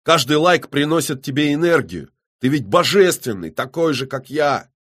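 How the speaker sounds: loud, high, fervent proclamation; barked. A narrator's reading. Killer tells the rival they are both divine, barking. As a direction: preach your power at him fiercely. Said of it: "рявкнул Доген".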